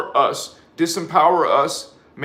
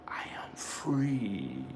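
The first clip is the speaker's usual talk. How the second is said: slow whisper, much softer; whispered affirmation; 4 semitones lower, 12 dB quieter, much slower than usual. Shown as a demonstration. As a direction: breathe the words out as a hushed affirmation. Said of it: "Say it with me again, holy ones, three times".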